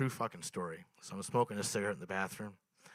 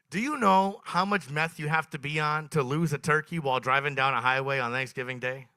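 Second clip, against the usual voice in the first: normal talking voice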